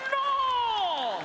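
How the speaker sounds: high loud DJ voice; Mexican radio DJ. Retold or imitated. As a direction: snap it like the radio host. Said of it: "He sounded just like that".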